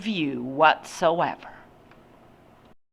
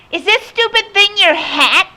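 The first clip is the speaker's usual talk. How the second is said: put-on high, loud bratty child; rude child's voice; 8 dB louder than usual. A narrator's reading. Child holding up the man's hat, rude and loud.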